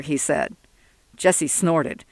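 ordinary delivery

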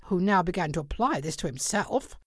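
usual speaking voice

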